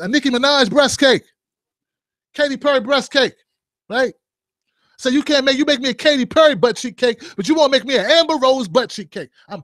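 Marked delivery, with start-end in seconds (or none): none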